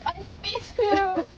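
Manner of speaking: dismayed wail. Real, not acted. Player misses her ultimate.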